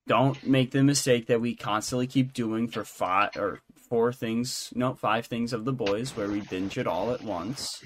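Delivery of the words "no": normal talking voice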